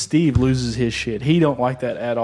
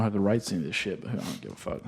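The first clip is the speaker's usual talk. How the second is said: muttered aside; 5 semitones lower, 11 dB quieter, faster than usual. Real, not acted. Aside about copyright rights.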